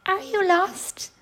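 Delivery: high, slow, friendly little bear. Acted. Baby bear greets a lost girl, high friendly voice. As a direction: squeak a friendly question, unhurried. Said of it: "he said in a friendly voice".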